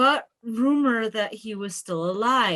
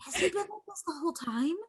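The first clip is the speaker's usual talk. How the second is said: fast, hushed aside; soft, quick and higher, kept between two people; whispering; 7 semitones higher, 9 dB quieter, much faster than usual. Acted. Shara asks Ashan about the god, hushed.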